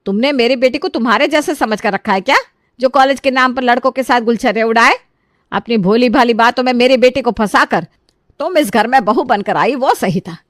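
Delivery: indignant mother-in-law telling off; scolding. A narrator's reading. Mother-in-law berates her son's wife over her daughter, scolding. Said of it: "खरी खोटी सुनाने लगते हैं".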